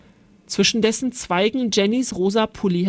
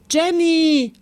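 high, loud shout of a name; drawn-out alarmed holler; yelled call; 8 semitones higher, 5 dB louder than usual. A narrator's reading. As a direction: bellow for a missing sister. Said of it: "brüllte er".

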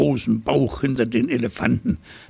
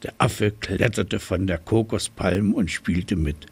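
usual speaking voice

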